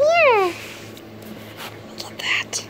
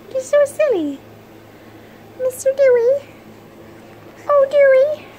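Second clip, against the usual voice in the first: high sing-song pet talk, much higher; baby talk to a pet; 7 dB louder than usual. Acted. Owner greeting the puppy, high cooing baby talk.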